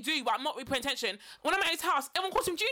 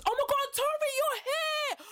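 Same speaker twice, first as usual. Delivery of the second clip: excited greeting; 13 semitones higher, slower than usual. Retold or imitated. Friend greets her at skating.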